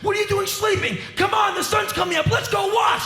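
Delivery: high, loud eager wake-up call; old bohemian rousing a tired friend, hammed-up shout; hyper old bohemian. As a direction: shout like a wired sixty-five-year-old. Energetic old bohemian voiced.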